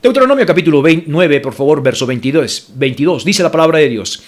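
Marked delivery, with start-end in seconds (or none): none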